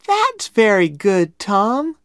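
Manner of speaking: high, loud, drawn-out praise; admiring little girl. A narrator's reading. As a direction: praise him slowly in a high girl's voice. Becky praises her portrait.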